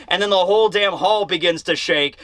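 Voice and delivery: ordinary talk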